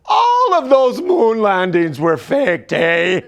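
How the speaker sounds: loud high Canadian accent, slow on the faked line; Canadian nurse bursting out loud, ending eh; Canadian nurse exploding with a loud, slow claim. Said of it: "the Canadian chemo nurse, she explodes".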